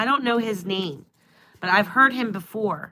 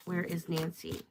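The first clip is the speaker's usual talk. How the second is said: hushed asking; 3 semitones lower, 10 dB quieter than usual. Real, not acted. Reader asking the cards again, hushed. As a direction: drop to a hush on the repeat.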